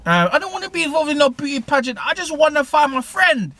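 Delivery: high-pitched mock girl voice, pleading; protesting girl. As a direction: play the scared young woman saying no, high. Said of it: "He's like".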